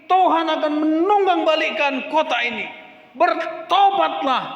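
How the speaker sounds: teriakan nabi yang keras dan tinggi; Yunus berteriak. Acted. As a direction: serukan seperti nabi di tengah kota. Yunus berseru kepada kota. (seruan lantang Yunus kepada kota Niniwe)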